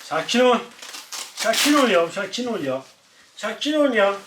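high, loud pleading calm-down; calming the dog. Real, not acted.

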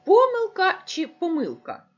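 помилка said twice, with the stress moved the second time; loud, high question setting the two stresses of помилка side by side; two stresses contrasted. Shown as a demonstration. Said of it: "Як правильно наголошувати?".

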